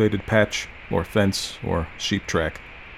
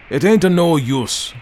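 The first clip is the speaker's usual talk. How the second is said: put-on slow, higher, louder grumbling old man; old man's growl; 7 semitones higher, 5 dB louder than usual. A narrator's reading. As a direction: drag it out like a stubborn old grump. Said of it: "growled he".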